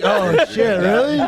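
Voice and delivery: mock shocked oh shit; feigned surprise, pitch jumping up; surprised. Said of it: "I was like".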